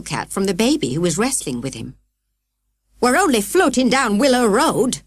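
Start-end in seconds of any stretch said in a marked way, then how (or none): 3.0-5.0 snap. A narrator's reading. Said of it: "snapped Grandma".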